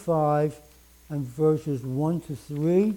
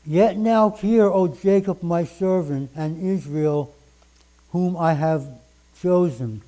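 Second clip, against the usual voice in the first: louder, solemn proclaiming of the verse; booming King James reading; 5 dB louder than usual. Acted. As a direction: declaim the old scripture at full force. Reads King James verses aloud.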